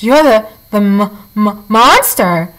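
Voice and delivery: slow, halting, scared stammer; frightened stammer. Acted. Possum meeting the robot, stammering in fright. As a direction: stumble over the word in fear.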